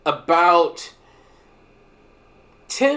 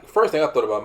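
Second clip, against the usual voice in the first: ordinary talk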